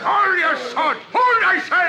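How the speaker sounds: loud commanding shout. Acted. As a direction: yell to stop the fight. Silver stopping a stabbing.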